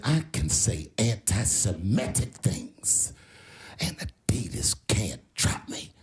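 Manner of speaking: low, slow defiant imitation. Comedian imitating an angry rapper on a podcast, low defiance. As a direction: space the words out, deep and challenging. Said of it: "He was mad about something".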